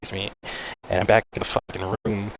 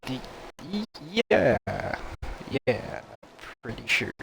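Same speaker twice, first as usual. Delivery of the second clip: hesitant; 5 semitones higher than usual. Retold or imitated. Doubting patient answers surgeon.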